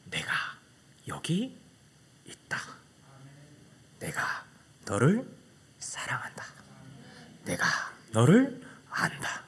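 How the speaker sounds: soft, slow whisper; whispered divine voice. Retold or imitated. God speaks to the boy within, hushed whisper. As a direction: breathe each word like a secret promise. God's voice inside.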